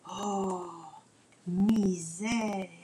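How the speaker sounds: soft, breathy whisper of dismay; hushed oh no. Acted. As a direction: murmur it in shock. Finds grandpa hanged upside down.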